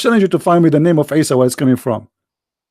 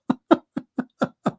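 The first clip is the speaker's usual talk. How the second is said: breathy laugh; 7 dB quieter than usual. Real, not acted. Laughing at the admin's scolding.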